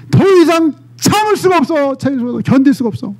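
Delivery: high, strained cry of a man who can't hold back; Joseph breaking down. Acted. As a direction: burst out as Joseph at his limit.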